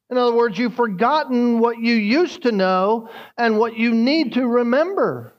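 fervent sermon delivery; preaching. Acted. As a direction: preach it out to the congregation with rising force. Preaching at full force.

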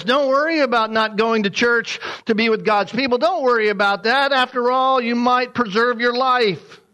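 lightly voiced worldly advice. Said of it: "You see, the world says".

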